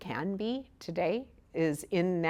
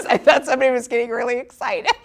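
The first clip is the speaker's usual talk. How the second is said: high, loud, quick burst through laughter; laughing; 13 semitones higher, 9 dB louder, much faster than usual. Real, not acted. Meditation teacher jokes to class about noise outside, laughing. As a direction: crack up while getting the words out.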